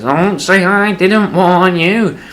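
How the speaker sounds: put-on high, very slow old man voice; old man warning. Old man leaving son's house, high slow warning. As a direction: drag out the parting warning.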